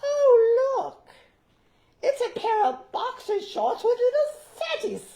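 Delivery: put-on very high, loud falsetto old lady, laughing; a giddy old woman showing off her gift; Missus Fezziwig. As Missus Fezziwig showing her husband the box, shrill falsetto. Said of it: "And I went".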